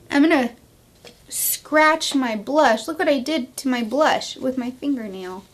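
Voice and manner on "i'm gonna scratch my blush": louder, slow groaning dismay; laughing dismay at the mistake. Real, not acted. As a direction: drag out the disappointment. After accident, slow dismay.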